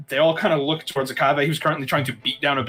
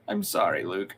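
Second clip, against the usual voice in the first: soft apology; 7 semitones lower, 6 dB quieter than usual. Acted. Remorseful NPC apology.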